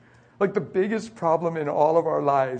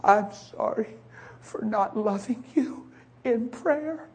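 imitating wife; 6 semitones higher, 4 dB quieter, much slower than usual. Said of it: "She said".

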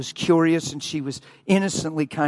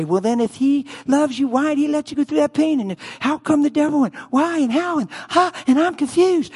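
high, whiny questioning voice; higher mocking whine; mock confused whining; 9 semitones higher than usual. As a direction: mimic a doubter who can't understand. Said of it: "And people say".

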